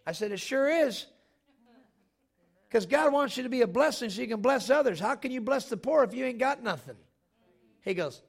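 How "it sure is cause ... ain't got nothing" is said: loud, high preacher's comeback; forceful retort. Retold or imitated. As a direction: fire back at the heckler, raised voice. Retort to heckler, acted out.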